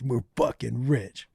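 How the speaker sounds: low, soft, slow retold awe; imitated excitement under the breath; hushed excitement. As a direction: savor it quietly, acting out the thrill. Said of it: "they were so excited".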